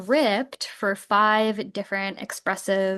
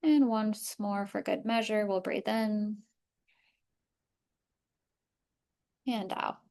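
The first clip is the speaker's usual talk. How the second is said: softer, even voice easing listeners through the body; guided body scan; 6 dB quieter than usual. Acted. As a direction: lead a calm breathing and body-awareness practice. Leads a body scan meditation.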